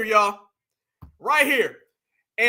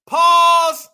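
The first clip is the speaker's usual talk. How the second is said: 1 loud pause call; 5 semitones higher, 10 dB louder than usual. Real, not acted. Streamer yelling pause at clip.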